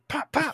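two punchy popped gunshot sounds; pop-pop gun noise; imitated gunshots. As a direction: mimic a quick double tap, high and playful. Imitating gunshots of a double tap.

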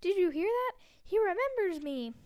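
high, loud delighted cry; excited cry. A narrator's reading. Diggle crying out to the crowd in delight. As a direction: burst out with thrilled joy. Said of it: "cried Daedalus Diggle".

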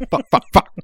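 loud high frustrated cursing; real frustrated outburst. Real, not acted. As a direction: blow up at yourself. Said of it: "I can't do it justice".

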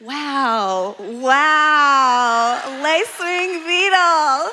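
high, slow, drawn-out wow; mock awe. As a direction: savor the words like a convert. Retelling being won over by beetle.

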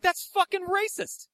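high, slow protest; mock indignation. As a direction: stretch out the outraged complaint, very high. Acted quarrel with the fan.

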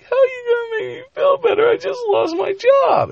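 very high crying falsetto; distraught woman. Acted. Fired woman protesting to the professor, high tearful wail. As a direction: squeal it tearfully as a mock woman.

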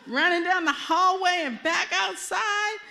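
high, loud, drawn-out comic wail; retold screaming panic. Retelling fleeing the mortuary, in a loud high mock scream. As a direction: belt out the panicked flight, stretching the words. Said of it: "screaming and crying".

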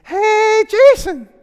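high, drawn-out call across a field; imitated kid's yell. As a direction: mimic a kid hollering from far away. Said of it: "I hear this kid yell across the field".

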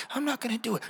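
put-on high, soft innocent child voice; kid's voice. Kid promising the adult not to squirt, high soft voice. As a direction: answer in a small high kid voice.

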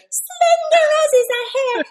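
very high falsetto Barbie voice. Shown as a demonstration. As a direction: squeak it up like a doll. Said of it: "It's very high".

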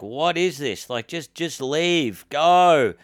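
half-voiced crowd yell, building at the end; imitated yelling out. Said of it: "People yelling out".

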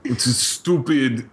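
petulant whiny rant impression; mock angry director. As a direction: sneer it like a sulky director. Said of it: "he was like".

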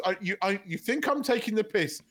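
ordinary delivery

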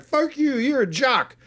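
high, heated mock shouting back; mock angry retort. Podcaster voicing Kevin Dillon's character snapping back, high and mock heated. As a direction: fire it back at the jock. Retold quarrel between movie characters.

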